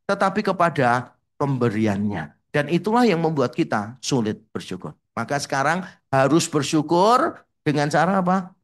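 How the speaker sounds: normal talking voice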